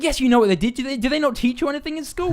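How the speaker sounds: high, loud mock scolding; aggressive driver. Irate taxi driver scolds passenger, aggressively retold. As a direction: imitate an indignant driver hammering his accusation. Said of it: "starts getting a bit aggressive".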